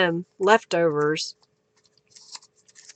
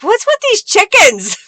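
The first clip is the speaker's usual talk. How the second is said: mock puzzled viewer; 7 semitones higher than usual. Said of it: "So everybody's like".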